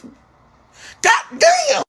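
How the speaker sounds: high, drawn-out disbelief; slow, high-pitched comic astonishment; stunned exclamation. Retold or imitated. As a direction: stretch it out way up high in shock. Reenacting shock at seven siblings.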